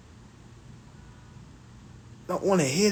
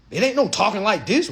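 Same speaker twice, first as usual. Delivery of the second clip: usual speaking voice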